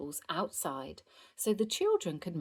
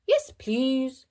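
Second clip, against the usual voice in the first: high, loud toy-owl voice; raised little puppet voice; 7 semitones higher, 6 dB louder than usual. A narrator's reading. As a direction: answer eagerly as a child's puppet. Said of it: "Susie replies as Owlie".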